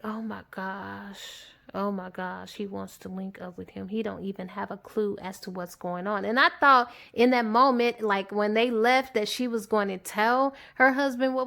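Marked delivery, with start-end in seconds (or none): 0.0-6.2 low, hushed retold dismay; quiet dismay. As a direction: murmur it as a worried aside. [name] privately dismayed by husband's offer.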